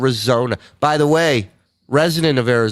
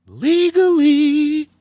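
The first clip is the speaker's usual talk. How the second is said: much higher, very slow put-on word; mock prim voice; 4 dB louder, much slower than usual. A 420 joke capped in a high prim voice.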